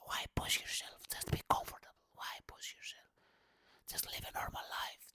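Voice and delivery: hushed, breathy whispered taunts; a sneering inner whisper, barely voiced; nagging inner whisper. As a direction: whisper it like a doubting voice in your head. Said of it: "this nagging voice that says".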